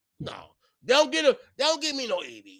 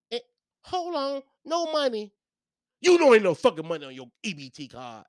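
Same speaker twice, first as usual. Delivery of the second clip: ordinary talk, nothing marked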